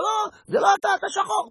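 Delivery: very high panicked refusal; startled woman shrieks very high; frightened shriek. Acted.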